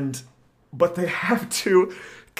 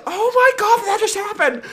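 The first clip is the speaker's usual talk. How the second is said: very high-pitched hammed-up wail; mock sobbing. Retelling the heroes' breakdown in the spaceship, mock sobbing. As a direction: imitate two people bursting into overwrought sobs. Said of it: "they just both start sobbing".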